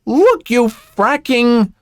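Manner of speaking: high, drawn-out exasperated outburst; angry outburst. A narrator's reading. Mother nearly knocked over confronts woman, angry outburst. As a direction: let the temper burst, pitch shooting up.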